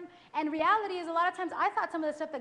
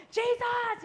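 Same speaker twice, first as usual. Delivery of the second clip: excited shout; 9 semitones higher, 6 dB louder than usual. Retold or imitated. Excited new believer calling out.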